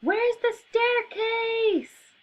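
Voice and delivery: high, slow, drawn-out angry cry; cross shout. Acted. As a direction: demand it, furious and stretched. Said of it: "Mary is very cross".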